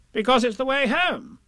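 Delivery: high, insistent character voice; know-all Rabbit. A narrator's reading. Rabbit telling Pooh the way home, high and certain. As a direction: answer as Rabbit, pitched right up.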